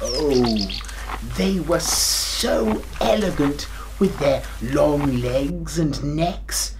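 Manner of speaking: ordinary delivery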